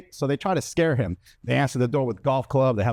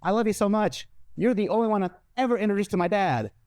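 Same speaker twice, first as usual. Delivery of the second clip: higher, mock sweet girlfriend; girlfriend quote; 7 semitones higher than usual. Girlfriend gushing love, voiced higher. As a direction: gush it like the smitten girlfriend. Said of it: "and I quote".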